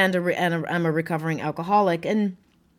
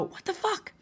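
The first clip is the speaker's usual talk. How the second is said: much higher, much softer stunned question; stunned whisper-soft what; 11 semitones higher, 9 dB quieter than usual. Retold or imitated. Couple staring at each other after remission, stunned. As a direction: breathe it out in disbelief. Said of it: "look at each other and go".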